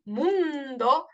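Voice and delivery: MUNDO with the U drawn out; stressed vowel demo. Shown as a demonstration. Teacher repeating mundo to show its stressed U. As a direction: stretch the U of MUNDO. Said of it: "U en la U".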